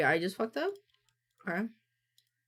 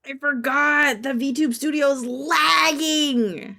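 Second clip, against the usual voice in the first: loud, higher and slow alarmed outburst; panicked exclaim; 6 semitones higher, 7 dB louder, much slower than usual. Real, not acted. Streamer realizes the model is lagging, loud dismay. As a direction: blurt it out in real dismay.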